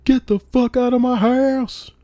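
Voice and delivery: furious shout at the intruder; yell. Retold or imitated. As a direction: scream him out of the house. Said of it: "I yell".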